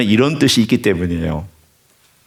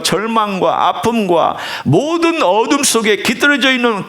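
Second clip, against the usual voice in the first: impassioned preaching; 12 semitones higher than usual. Acted. Preacher urging the congregation to feel the pain, impassioned. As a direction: preach it from the heart, rising high.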